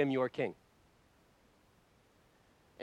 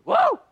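ecstatic shout; 15 dB louder than usual. Real, not acted. Overwhelmed exclamation in worship.

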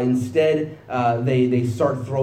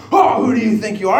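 higher and louder, a mock online outburst; indignant retort; 8 semitones higher, 7 dB louder than usual. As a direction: snap back like an offended commenter. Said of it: "and you go".

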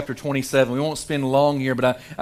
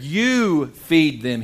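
"You" stressed, very slow and firm; "You feed them" drawn out, "You" weighted; emphatic you; much slower than usual. Acted. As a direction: lean on "You" like a command. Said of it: "Jesus goes right to this emphatic imperative".

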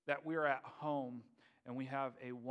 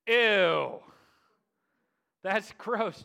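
loud, high, drawn-out revulsion; disgusted; 5 semitones higher, 14 dB louder, much slower than usual. Retold or imitated. Crowd reacting to eat my body, loud disgust. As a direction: recoil vocally, mock grossed-out and loud.

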